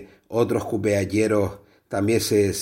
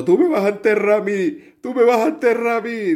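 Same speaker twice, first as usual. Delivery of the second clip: much higher, louder mock wailing wife; crying wife; 11 semitones higher, 4 dB louder than usual. Crying wife wailing at her drunk husband, high mock sobbing voice. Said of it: "y la mujer llorando".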